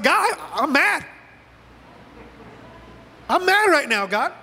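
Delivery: high-pitched mock anger; acted complaint to God. Preacher models telling God his feelings, mildly acted anger. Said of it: "Just tell him".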